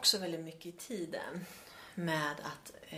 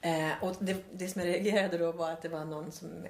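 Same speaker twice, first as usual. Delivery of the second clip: usual speaking voice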